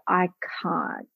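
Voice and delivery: a hushed, scared little protest; timid refusal. Retold or imitated. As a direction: back away from it, barely daring to speak. Client voiced refusing to publish.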